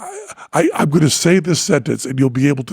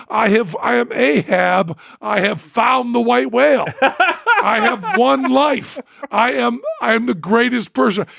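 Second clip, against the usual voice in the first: higher, laughing triumphant proclamation; ecstatic boasting; 6 semitones higher than usual. Retold or imitated. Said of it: "I am dancing and waltzing".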